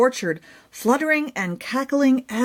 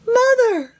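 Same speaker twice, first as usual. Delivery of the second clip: high, squeaky call of mother; tiny mouse cry; 12 semitones higher, 4 dB louder than usual. A narrator's reading. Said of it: "the word that reached Pumpkin's heart".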